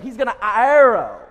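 a sudden loud shout on the last word; climactic cry. Real, not acted. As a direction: burst out with it. Preacher's climactic exclamation.

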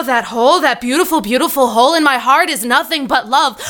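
usual speaking voice

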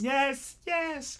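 put-on very high childish yes; high chirpy reply; kids' chorus. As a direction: answer like a grade school class. Mimicking kids answering in chorus.

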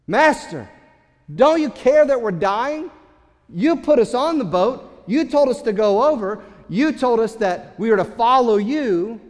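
loud, high frantic accusation from drowning disciples; brazen emotional demand. Retold or imitated. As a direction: shout it at the sleeping master in panic. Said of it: "go up to him in a brazen way and say".

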